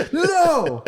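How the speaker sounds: high, squeaked-out laughing no; a strangled, horrified no. Retold or imitated. Cortez hearing they kill people, squeaked and laughing.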